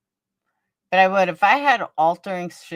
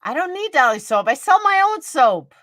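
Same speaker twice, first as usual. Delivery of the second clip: rising higher and louder, indignant; defiant; 8 semitones higher, 5 dB louder than usual. Real, not acted. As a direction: fire back proudly, getting shriller. Defiant retort to a rival.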